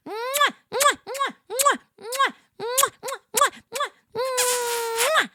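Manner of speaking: high, loud smacking air kisses to chat; blown kisses. Real, not acted. Streamer thanking a donor, blowing loud high kisses. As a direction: kiss after kiss, squealed high.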